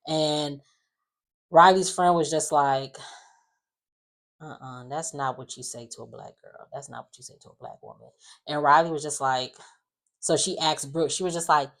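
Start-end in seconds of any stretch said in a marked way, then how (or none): 4.4-8.1 very soft, low imitated aside; quiet disapproval. Riley's friend correcting her, hushed and low. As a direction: drop way down in volume to imitate disapproval.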